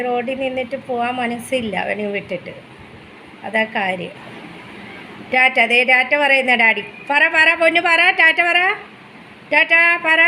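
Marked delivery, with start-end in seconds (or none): 5.3-10.2 higher, louder sing-song coaxing. Real, not acted. Mother coaxing baby to say ta-ta to daddy, sing-song.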